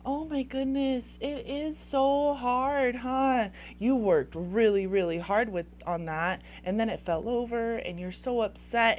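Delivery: high-pitched, gushing sympathy; super empathetic. Acted. Parent consoling a child over fallen blocks, very empathetic. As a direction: coo with exaggerated sympathy, stretching key words. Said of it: "you're gonna be empathetic".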